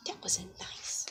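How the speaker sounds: hushed whisper. Real, not acted. Reader whispering to the child about the mean hens. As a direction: lean in and whisper it. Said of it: "reader aside to child".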